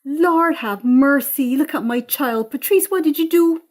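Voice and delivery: a mother horrified at her daughter; Eunice. Acted. Eunice seeing drunk Patrice, shocked and loud. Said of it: "and gasps".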